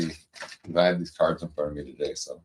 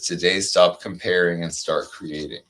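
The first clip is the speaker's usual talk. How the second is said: slow, soothing card-reading voice; 2 semitones lower, slower than usual. Acted. Reading an oracle card to her friend, slow and soothing. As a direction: read it like a gentle affirmation, unhurried.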